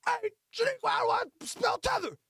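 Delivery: high-pitched holler into another room; mock clueless man yelling over; calling out. Calling to woman in other room.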